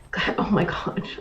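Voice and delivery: mortified breathy whisper; embarrassed whisper. Real, not acted. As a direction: hide it under your breath.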